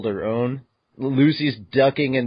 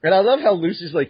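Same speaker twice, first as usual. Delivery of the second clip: ordinary delivery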